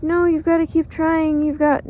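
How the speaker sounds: high, mocking nagging voice; mocking people. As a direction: parrot their advice mockingly. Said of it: "People are like".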